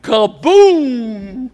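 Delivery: high, punchy kaboom; mock crash sound. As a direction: smack out the impact like a cartoon. Being knocked off the stage.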